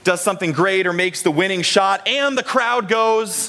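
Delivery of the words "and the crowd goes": drawn-out, higher-pitched play-by-play call; sports commentator. Acted. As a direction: stretch it out like announcing a winning shot. Mimics sports call of winning shot.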